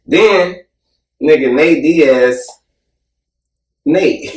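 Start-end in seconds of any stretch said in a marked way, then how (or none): none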